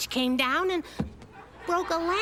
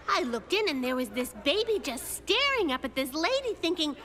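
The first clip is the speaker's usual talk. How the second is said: ordinary talk, nothing marked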